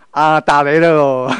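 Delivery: playfully slow mock announcement; mock nonchalant. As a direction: brush off the scolding like it's only the weather. Husband jokes while wife scolds.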